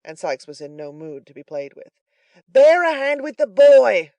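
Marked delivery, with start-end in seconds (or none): 2.5-4.1 much louder, higher, slow shout; furious shout. A narrator's reading. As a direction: bellow the order. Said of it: "cried Sikes, beckoning furiously".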